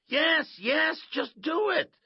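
very high, loud cry; panicked shout. Acted. Boy urging Coach to swat the wasp, panicked shout. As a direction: yell in desperate, high-pitched urgency. Glenn awaits Coach's clipboard blow.

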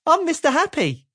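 high, loud, bright hello; cheerful Mister Happy. Acted. Mister Happy greets the stranger, high and cheerful. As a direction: greet him with beaming cheer.

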